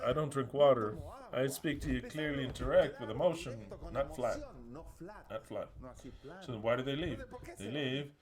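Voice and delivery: normal talking voice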